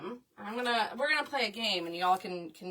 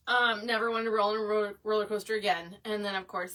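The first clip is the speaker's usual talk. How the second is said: ordinary talk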